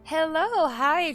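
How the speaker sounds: usual speaking voice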